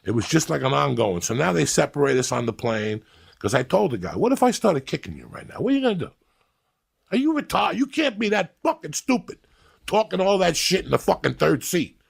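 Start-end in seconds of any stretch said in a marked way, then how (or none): 4.2-12.0 high-pitched, incredulous berating; heated retold tirade; scornful threat. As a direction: act out chewing someone out, voice pitched way up. Acts out berating the passenger.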